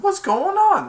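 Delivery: high loud confused yell; imitated bewildered cry; alarmed shout. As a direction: shout it as chaos breaks out. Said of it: "He said".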